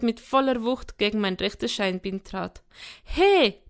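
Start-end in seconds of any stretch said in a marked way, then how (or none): 3.0-3.6 high, loud indignant exclamation; sharp cry of protest; complaining. A narrator's reading. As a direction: exclaim as if just kicked. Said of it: "beschwerte ich mich".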